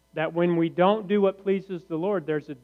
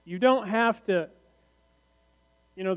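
ordinary talk

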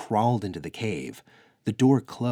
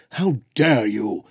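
loud furious outburst; thundering; 5 semitones higher, 10 dB louder than usual. Acted. Brona silencing the insolent detective, thunderous fury. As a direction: thunder it down at him. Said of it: "Brona thundered".

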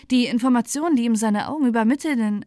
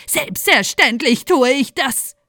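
put-on slow, louder, higher angry old woman; hissed retort; 4 semitones higher, 8 dB louder, slower than usual. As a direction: spit it out slowly and sharply. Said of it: "zischte sie zur Antwort".

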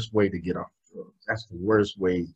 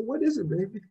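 put-on softer, higher motherly "baby"; gentle mother; 12 semitones higher, 4 dB quieter, faster than usual. Mother gently answering her son at night, soft. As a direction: ask tenderly like a worried mom.

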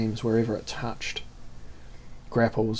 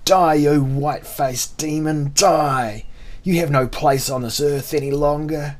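raised, higher-pitched battle cry; shouting; 4 semitones higher, 4 dB louder than usual. A narrator's reading. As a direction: shout it at the enemy you want dead. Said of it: "heard the man shouting at him".

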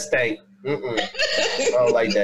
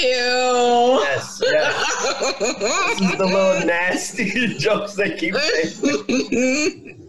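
higher, slowly laughing through the words; 8 semitones higher, much slower than usual. Real, not acted. Texan telling the pecan joke, laughing through it. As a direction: giggle out the joke's punchline.

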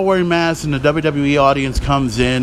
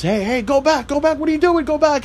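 much higher, urgent calls to go back; frantic official yelling; 10 semitones higher than usual. Retold or imitated. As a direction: wave the ambulance back in a panic. Adam Pearce yelling at ambulance.

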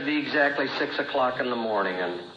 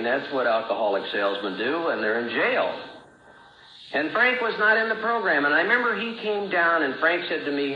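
ordinary delivery

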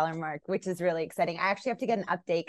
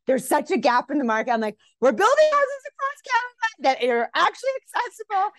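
rising to a loud, high-pitched exclamation; excited pitch; 13 semitones higher, 8 dB louder than usual. Retold or imitated. Sharing a housing idea with her husband, loud and excited. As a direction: blurt the big idea loudly, voice shooting up.

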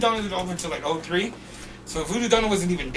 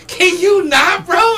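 loud, high-pitched retold outcry; incredulous protest; 13 semitones higher, 9 dB louder, much slower than usual. Spat-on worker to the bum, loud incredulous protest. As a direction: shout it in giggly disbelief, pitched way up. All he said after being spat on.

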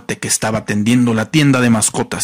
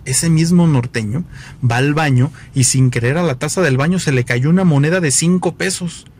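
ordinary talk, nothing marked

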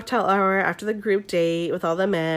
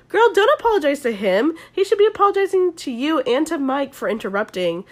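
sassy scolding; 6 semitones higher than usual. Retold or imitated. Teller scolding Hannah in mock quote.

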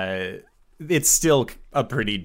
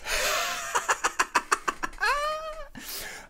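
long, high breathless cackle; villainous skull laugh; 5 dB quieter than usual. Acted. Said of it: "very loudly just".

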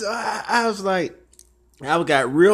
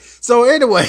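loud laughing so anyway; 7 semitones higher, 10 dB louder than usual. Real, not acted. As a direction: burst out laughing. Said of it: "laugh shout".

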